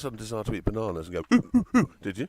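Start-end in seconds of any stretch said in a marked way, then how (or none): 1.4-1.9 high, loud monkey calls; mocking chimp ooh-ooh-ooh; monkey hoots. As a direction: hoot like an excited monkey. Monkey noises mocking the story.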